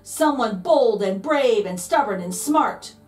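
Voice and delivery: ordinary delivery, nothing marked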